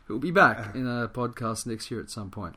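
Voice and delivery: ordinary talk, nothing marked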